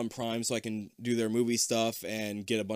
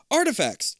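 high, loud interjection; sudden high-pitched announcement; chiming in; 13 semitones higher, 6 dB louder than usual. A narrator's reading. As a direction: pipe up brightly as the dad. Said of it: "your dad chimes in".

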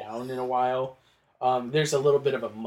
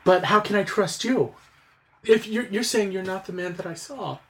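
lightly voiced anxious servant, higher pitched; a hint of a mistrustful servant, pitched up; wary servant; 6 semitones higher than usual. Servant unsure she can trust the stranger, mildly anxious.

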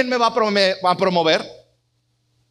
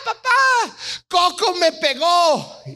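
put-on high, whiny child's plea, much higher; tattling to dad through tears, pitched up high; crying little girl; 6 semitones higher, slower than usual. Little daughter running to dad, crying and complaining. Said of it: "Mariana viene conmigo llorando y me dice".

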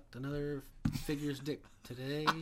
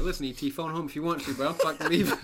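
laughing mock bravado; 5 semitones higher, 11 dB louder than usual. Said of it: "I'm like".

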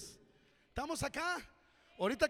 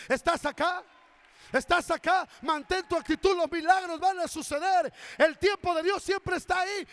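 loud, fervent sermon delivery, lines driven hard; full-force preaching; 11 dB louder than usual. Acted. Pastor urging his congregation to act in faith, loud fervent preaching. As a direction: preach it out to the whole church.

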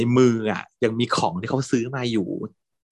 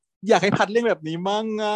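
whiny wish; 11 semitones higher than usual. Retold or imitated. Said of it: "บอกว่าแบบ".

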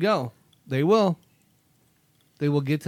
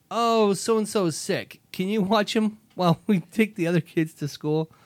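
mock pleading request; 6 semitones higher, 4 dB louder than usual. Voicing kids asking again.

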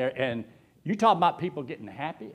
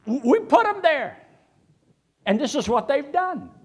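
preaching at full force; 6 semitones higher, 3 dB louder than usual. Acted. Preacher to the congregation about debt, high and loud. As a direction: hammer the point home.

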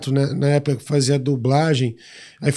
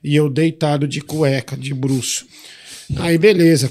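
ordinary talk, nothing marked

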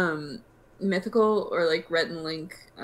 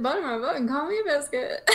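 Southern grandma; 7 semitones higher than usual. Retold or imitated. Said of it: "to sound like a Southern grandma".

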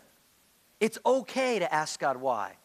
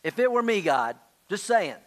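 higher, louder, slow and a little smug; cheeky complaint to God; 4 semitones higher, 4 dB louder than usual. Retold or imitated. Preacher voicing a believer telling God off, mildly sassy. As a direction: tell God you'd do better, half joking. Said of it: "In other words".

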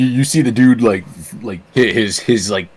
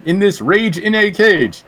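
wrestling promo; 6 semitones higher than usual. Pretend. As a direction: threaten your opponent in the ring. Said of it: "You didn't practice this promo?".

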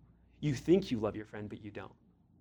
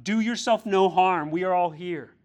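a loud, higher cry; Paul shouting to the jailer, raised and loud; 4 semitones higher, 7 dB louder than usual. Acted. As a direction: call out urgently to save him. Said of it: "Paul shouted".

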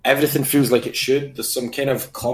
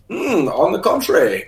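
higher mock refined villain; smug calculated villain; 6 semitones higher than usual. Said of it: "this one's like".

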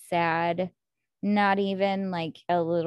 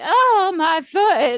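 high, loud theatrical moan; hammed-up wail of agony; dramatic moaning; 9 semitones higher, 9 dB louder, much slower than usual. Melodramatic Victor on the couch with a hurt foot, moaning theatrically. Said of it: "moan periodically".

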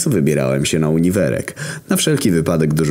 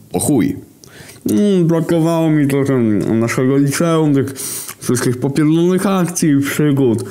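wysoki, podpity głos Głoda; mów jak wesoły pijany student, wysoko; drunk Głód; 13 semitones higher than usual. A narrator's reading. Głód talks, narrated as him. (Głód chwali się bratu, wysoki pijany głos)

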